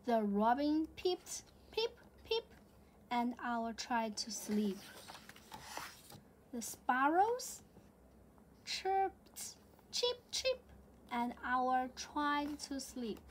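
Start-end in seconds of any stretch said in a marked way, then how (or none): 1.7-2.4 high little peep; robin peep. Acted. Robin sound in storybook. 9.9-10.6 high chirpy chip chip; sparrow chirp. Acted. Sparrow sound in storybook.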